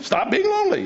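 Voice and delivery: high, mocking "stop being lonely"; preacher lampooning the advice to stop feeling lonely; mock command. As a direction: squeak the advice in mock disbelief.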